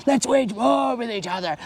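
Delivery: high-pitched mocking imitation; mock squabbling. Preacher mimicking people fighting each other, mock squabbling. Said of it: "grumbling and complaining and fighting".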